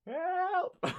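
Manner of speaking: tiny high squeal for help; mock scream. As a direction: squeak it out softly, then crack up. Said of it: "he just starts screaming".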